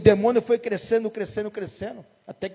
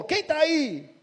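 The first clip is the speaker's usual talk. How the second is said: pastor questioning; 6 semitones higher, much slower than usual. Retold or imitated. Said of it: "E o pastor perguntou".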